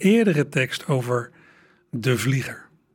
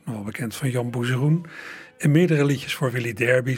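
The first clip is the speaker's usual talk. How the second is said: ordinary delivery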